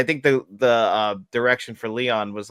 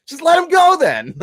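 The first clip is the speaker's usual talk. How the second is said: high, loud movie-quote yell; imitated exasperated shout; 10 dB louder than usual. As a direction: call it out as Sal, high and loud. Mimics Sal calling out from film.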